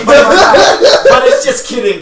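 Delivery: high, loud, slow laughter; laughing hard while talking. Real, not acted.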